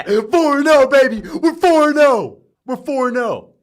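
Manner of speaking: high, loud triumphant yell; victory shout. Real, not acted. Streamer celebrating four and oh, loud triumphant yelling. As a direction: crow about the record at full voice.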